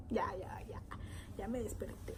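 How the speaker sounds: groggy whisper, barely awake; sleepy whisper. Retold or imitated. To the coworker waking her, sleepy whisper. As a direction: mumble it half asleep under your breath. Groggy reply to being woken.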